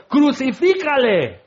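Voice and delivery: high, slow shout of a mob; crowd shout. Acted. Preacher voicing the crowd at the cross, shouting. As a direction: yell it like an angry crowd.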